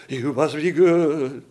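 "You must be good" slow, loud and heavy; mock emphasis. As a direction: lean hard on every word, slowly, mocking. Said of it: "read it with emphasis on".

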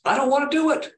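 a high, strained protest of a man who does not want this; anguished plea. Acted. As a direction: let the reluctance break upward. Jesus' honest temptation voiced.

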